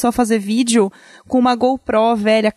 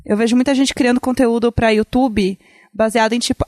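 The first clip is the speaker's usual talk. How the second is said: normal talking voice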